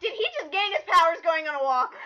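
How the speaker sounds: loud, strained, near-crying question; incredulous shout. Real, not acted. Reviewer shouts in disbelief at powers gained on a walk. Outraged at plot twist.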